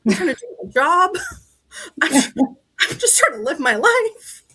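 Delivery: mock-exasperated protest, pitch rising with each phrase; pissed off. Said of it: "So I'm getting pissed off now".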